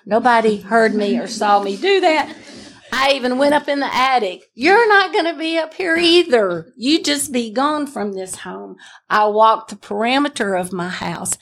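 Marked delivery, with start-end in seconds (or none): 4.5-8.8 pitched-up command to Satan; commanding rebuke. Retold or imitated. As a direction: order the devil out of the attic.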